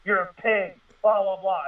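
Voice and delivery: mild mock rant; mock yelling. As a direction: mimic a friend telling off the cops. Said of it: "he was yelling at the cops".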